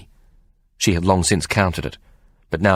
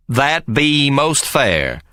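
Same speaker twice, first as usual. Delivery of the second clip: higher, very slow reply; Perryman's voice; 8 semitones higher, much slower than usual. Acted. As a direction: weigh it out slowly. Perryman answers.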